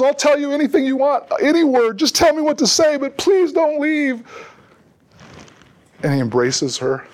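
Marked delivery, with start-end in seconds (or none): 0.0-4.2 put-on much higher child voice, begging, slowing at the end; pleading little girl. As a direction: beg like a little girl who can't let go. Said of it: "and she goes".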